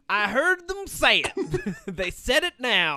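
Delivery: high, loud, drawn-out Southern twang; mock a scandalized Southerner, stretching the words; hammed-up Southern conspiracy believer; deep Southern accent. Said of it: "people with a deep Southern accent".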